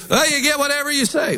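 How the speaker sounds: high-pitched mimicry of an objector; mimicking some people. Preacher quotes some people on faith, high mimicking voice. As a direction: toss it off high, quoting the doubters. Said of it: "'Cause some people".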